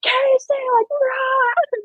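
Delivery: high, strained mock yell; imitated scream. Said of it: "He's just screaming. I just hear him yelling".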